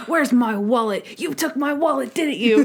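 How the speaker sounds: lightly voiced crotchety accuser, rising in pitch; cranky old man. Old patient accusing Monk over his wallet, mock-cranky. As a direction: accuse like a mildly hammed-up old grouch. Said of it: "What a cranky old man".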